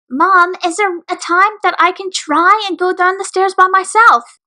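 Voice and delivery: put-on high childlike asking voice; young filly voice. A narrator's reading. Filly asks her mother.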